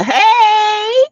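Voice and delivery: high, drawn-out greeting cry; high excited shout-out; excited hey. Real, not acted. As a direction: call out hey high to a friend.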